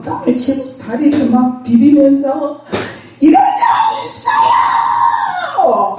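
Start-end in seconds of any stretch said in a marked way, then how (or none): 3.2-6.0 high, slow, drawn-out anguished wail; wailing cry to God. Retold or imitated. Pastor wailing to God alone in her church, slow high cry. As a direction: wail it out to heaven, much higher. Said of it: "막 이러고 막 내가 막 통곡을 했어요".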